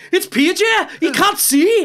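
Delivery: high-pitched Geordie catchphrase; Geordie accent. Acted. Comedian finding his way into Geordie with a catchphrase, high Geordie accent. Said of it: "you need a phrase to get you in there".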